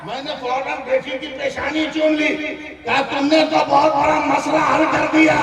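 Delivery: high, loud, ringing sermon punchline; climactic preaching. Acted. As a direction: drive it home with long, held shouted phrases. Preacher shouts the punchline of story.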